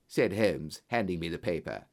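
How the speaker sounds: usual speaking voice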